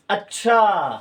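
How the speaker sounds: अच्छा said low and drawn out in surprise; a wondering अच्छा, voice dropping; surprised acchha. Shown as a demonstration. Teacher demos अच्छा as surprise to students. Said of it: "तो ये आश्चर्य हुआ".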